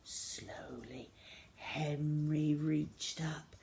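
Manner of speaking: hushed narrator whisper; slow suspense whisper. A narrator's reading. Tense narration, sneaking.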